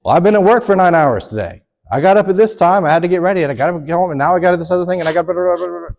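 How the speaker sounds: loud, high, rapid list of gripes from a worn-out man; husband rattling off excuses to his wife, loud and high; mock complaining husband. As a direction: whine about the long workday, way up and loud. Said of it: "it's not our job to say".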